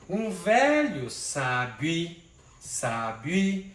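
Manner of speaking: very drawn-out, beat by beat; slow syllable scansion. Shown as a demonstration. Teacher slowing the verse to show the syllable merge. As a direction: pull each syllable apart slowly. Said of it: "aqui já foi pro outro canto".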